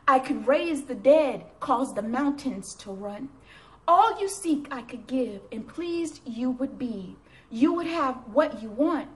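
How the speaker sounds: emphatic, measured recitation, phrase by phrase; loud line openings, falling phrase ends; poem reading. Acted. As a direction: declaim God's answer in earnest verse.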